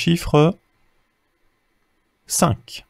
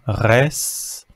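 slow blending. Shown as a demonstration. Teacher slowly blending "res" for a beginning reader. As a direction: slowly stretch "res" out syllable by syllable. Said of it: "Je te donne les sons".

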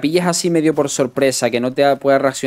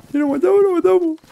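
put-on much higher Argentine accent, a bit less loud; Argentine impression again; 3 dB louder than usual. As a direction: repeat the Argentine guy, pitched way up. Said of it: "el argentino del coche".

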